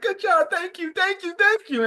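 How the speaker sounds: high-pitched, gushing put-on voice; squeaky-high mock gratitude; Luffy's excited thanks. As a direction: imitate an overjoyed hero piling on thanks. Acting Luffy's repeated thanks.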